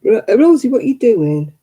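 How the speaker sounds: sharp loud call across the room; calling the dog. Real, not acted. Calling out to Rosie the dog digging the sofa, loud.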